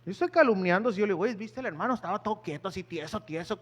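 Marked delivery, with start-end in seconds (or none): 1.2-3.6 soft, secretive gossip voice; hushed gossip. Shown as a demonstration. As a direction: lean in and murmur it like spreading a rumor. Gossip example about a brother. (chisme en voz baja)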